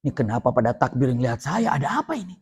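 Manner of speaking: half to himself, lost and unsure; man stunned by the takbir, softly confused; puzzled muttering. A narrator's reading.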